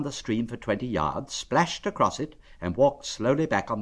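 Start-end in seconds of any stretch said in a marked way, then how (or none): none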